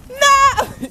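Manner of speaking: high squealed refusal; high playful plea. Real, not acted. Mom fending off a snowball, high squealed protest.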